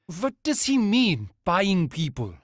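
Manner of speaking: put-on high, alarmed German-accented question; German accent, rising alarm. A narrator's reading.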